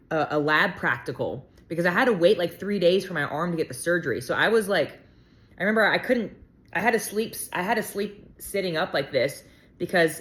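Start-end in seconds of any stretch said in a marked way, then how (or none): none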